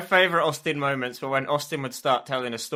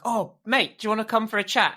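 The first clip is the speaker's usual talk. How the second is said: imitated chummy invitation; 8 semitones higher, faster than usual. Voices friend's breezy invitation.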